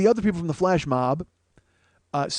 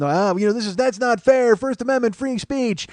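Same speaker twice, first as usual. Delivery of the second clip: jibber jabbering; 6 semitones higher than usual. Retold or imitated. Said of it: "start kinda jibber jabbering".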